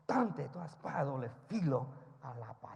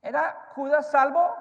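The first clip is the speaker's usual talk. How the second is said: shouted, much higher question to the church; booming question; 11 semitones higher, 15 dB louder than usual. Shown as a demonstration. Preacher to his church, shouted question. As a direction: thunder the question at the congregation. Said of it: "Pregunto, iglesia".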